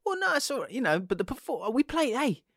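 high-pitched, hammed-up reasonable fan; mock reassurance. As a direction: excuse it in a high sing-song, pretending all is fine. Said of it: "and you go".

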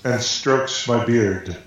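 slow, with a drawn-out pause before beard; slow dramatic pause. Pretend. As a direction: pause for effect. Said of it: "you gotta speed that up".

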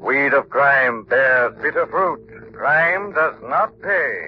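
very slow, low, menacing delivery; ominous slow voice. Acted. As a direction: pronounce each word like a dark verdict. Famous Shadow closing slogan.

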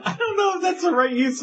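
high voice squeezed by laughing; cracking up while objecting; real helpless laughter. Real, not acted. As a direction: talk through a fit of laughter. Cracking up at friend's crude joke.

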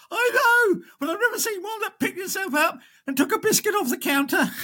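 very high, wailing punchline; hysterical barmaid. Acted. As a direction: sob it out as a shocked barmaid.

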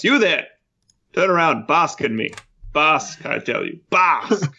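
high, hammed-up commanding calls; mock shouting. Jeffrey orders an uninterested crowd to bask in him, mock shouting. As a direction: demand attention, pitching up on each order. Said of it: "he shouts".

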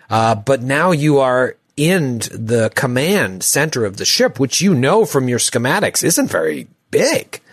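calm, descriptive scene-setting voice; game master scene setting. Acted. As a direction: paint the room for the players.

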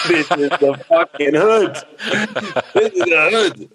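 half-laughing shock at the neighbourhood; laughing disbelief. Retold or imitated.

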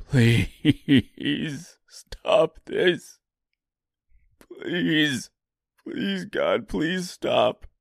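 high, breaking plea for it to stop; desperate begging. A narrator's reading. Beatrice begging God, frantic and pleading, voice climbing high. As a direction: beg God to make it stop.

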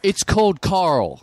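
slow American "Carl" with a full R; American pronunciation. Retold or imitated. Counter worker correcting his own name, slow American pronunciation. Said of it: "And she goes".

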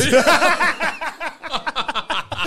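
loud, high, long burst of laughter; real, helpless laugh at the punchline; big laugh. Real, not acted.

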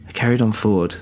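usual speaking voice

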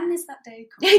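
high, loud plea for that day; mock impatient demand. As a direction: burst out in comic exasperation. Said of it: "And I'm just like".

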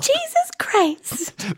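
very high, long-drawn mock curse; child imitating dad. Four-year-old sister imitating angry Dad, high drawn-out exasperation. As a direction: squeeze it high and stretch it out. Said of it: "So she'd be like".

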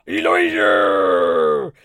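"Elijah" stretched very low and very slow; deep drawled Elijah. Shown as a demonstration. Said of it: "if he spoke like that".

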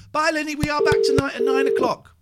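loud cheery farewell; loud sign-off. Real, not acted. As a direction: call it out to the stream.

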